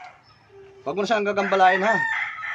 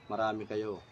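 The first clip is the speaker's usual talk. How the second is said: much lower, softer voice; low musing echo; 9 semitones lower, 12 dB quieter, slower than usual. Real, not acted. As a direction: repeat it quietly to yourself. Echoes the answer to himself.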